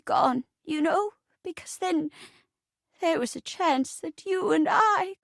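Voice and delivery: halting, breaking-off confession; flustered old granny. Acted. Old wife confesses feelings to detective, halting granny voice. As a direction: stammer guiltily like an old wife. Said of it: "I'll be playing the part of the poor old rocking chair".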